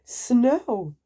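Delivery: thrilled to see the snow; child-like glee; excited cry. Acted. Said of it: "he was so excited".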